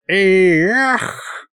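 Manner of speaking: high, mock-yelled exclamation; sudden whoop. A narrator's reading. Old sorcerer whoops before diving into his victim, yelling. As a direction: whoop it high without much volume. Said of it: "вдруг снова завопил Мировид".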